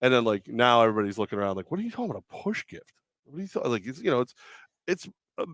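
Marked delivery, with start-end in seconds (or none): 1.7-3.6 high, quieter puzzled imitation; mock bafflement. Baffled coworkers ask about push gift, mock confusion. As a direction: imitate confused onlookers, pitched up.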